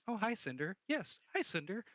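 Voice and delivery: high-pitched mock small talk; Cinder's self-chat. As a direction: imitate someone brightly greeting themselves. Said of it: "all you can hear is Cinder going".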